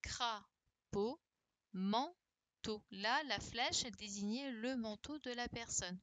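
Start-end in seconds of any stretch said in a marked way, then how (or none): 0.0-1.2 "crapaud" as two beats, "cra... paud"; split into syllables. Shown as a demonstration. Teacher reviewing the syllables for pupils, "crapaud" split in two. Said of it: "il fallait utiliser les syllabes". 1.7-2.8 "manteau" broken up, "man... teau"; split into syllables. Shown as a demonstration. Teacher reviewing the syllables for pupils, "manteau" split in two. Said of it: "il fallait utiliser les syllabes".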